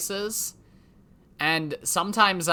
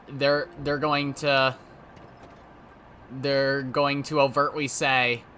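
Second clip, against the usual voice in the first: ordinary talk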